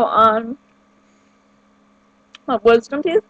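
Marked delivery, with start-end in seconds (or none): 2.5-3.2 talking around a candy; words slurred by candy in mouth; sucking a hard candy. Real, not acted. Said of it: "I can't talk with candy in my mouth".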